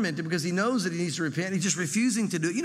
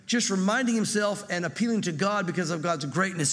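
ordinary delivery

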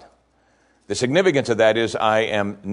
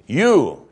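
You, punched higher and louder; stress on YOU; 5 semitones higher, 5 dB louder than usual. Shown as a demonstration. Preacher stressing You to the congregation, loud emphasis. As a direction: hit the word You hard. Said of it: "it's emphatic".

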